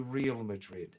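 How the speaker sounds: Real as plain English "reel"; anglicized pronunciation. Shown as a demonstration. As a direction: make "Real" rhyme with "feel". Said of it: "or number two".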